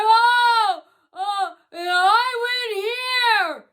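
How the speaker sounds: loud, high, drawn-out mocking holler; mock screaming. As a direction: imitate someone screaming chatter across a room. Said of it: "They're, like, screaming stuff".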